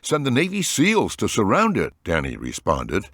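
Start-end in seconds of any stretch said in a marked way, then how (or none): 0.0-1.9 cracking voice. A narrator's reading. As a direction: let the voice crack. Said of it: "his voice cracking".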